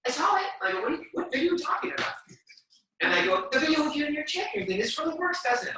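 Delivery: high, lightly voiced mom and son; retold phone dialogue. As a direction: voice both sides, lightly.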